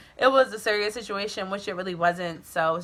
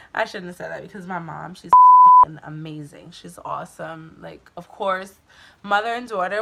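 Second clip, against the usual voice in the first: normal talking voice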